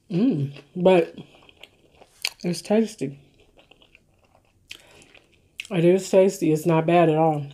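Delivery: eating between words; talking while chewing. Real, not acted. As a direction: mouth full of food. Seen 1: Bites sandwich at -3s, cheeks full and chewing throughout.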